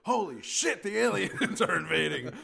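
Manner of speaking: high, very loud mock alarm; hammed-up panicked shout, breaking into laughter; meaning business. Fifties broadcaster warning of an invasion, loud mock alarm. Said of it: "you knew somebody meant business".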